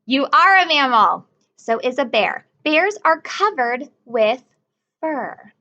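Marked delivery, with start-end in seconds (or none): none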